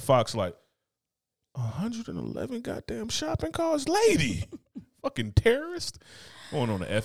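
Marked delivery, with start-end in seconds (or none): 1.5-6.0 shocked inmates. Retold or imitated. Inmates gasping at Fox.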